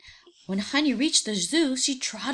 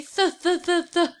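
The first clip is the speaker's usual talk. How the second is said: stuttering th; 7 semitones higher, 5 dB louder than usual. Shown as a demonstration. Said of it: "Point to your thumb and say".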